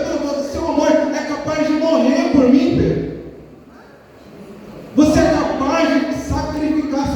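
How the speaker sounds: high-pitched, ringing sermon delivery; impassioned preaching. Real, not acted. Preacher questioning the church about Peter's love, impassioned preaching.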